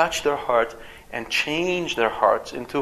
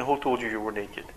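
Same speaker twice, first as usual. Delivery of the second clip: respectful tone; 4 semitones lower, 9 dB quieter than usual. Shown as a demonstration. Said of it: "Notice the respectful tone".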